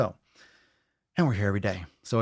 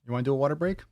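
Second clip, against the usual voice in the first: higher sing-song questions, rising at the end; coaxing pet talk; 4 semitones higher, faster than usual. Real, not acted. Host asking the pup to join a water break, coaxing.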